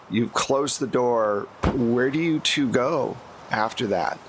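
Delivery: usual speaking voice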